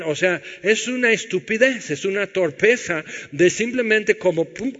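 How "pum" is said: ordinary delivery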